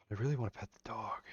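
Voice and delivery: hushed, quick whisper. Real, not acted. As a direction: whisper it eagerly. Streamer eager to pet dog.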